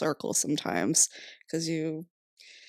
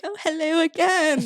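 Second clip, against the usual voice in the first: higher and louder mock greeting on "Oh, hello again"; sweet old mother with Alzheimer's; 11 semitones higher, 7 dB louder than usual. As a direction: greet her cheerfully again, as if just seeing her. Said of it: "she'd be like".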